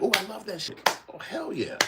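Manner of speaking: high squeaky mock-excited fan. Streamer mocking fans who only love the music, high-pitched.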